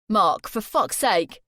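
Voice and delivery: loud, slow, exasperated; angry shout. Acted. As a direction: bark at him, fed up. Jay shouts at Mark.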